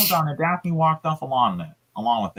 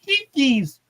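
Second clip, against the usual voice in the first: jinkies exclamation; 9 semitones higher than usual. Acted. Velma exclaims in reading.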